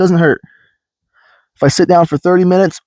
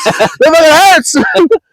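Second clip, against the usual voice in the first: high, slow yell of pain; 14 semitones higher, 3 dB louder, much slower than usual. Retold or imitated. Telling a friend about the eye doctor, slow high pained yell. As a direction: drag out the complaint in pain. Q-tip pokes metal in eye.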